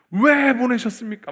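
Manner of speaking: acted outraged demand, high and loud; angry protest. Preacher imitating an angry prayer to God, loud high protest. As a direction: fling the question up in mock fury. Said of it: "분노를 느낄 정도로 막".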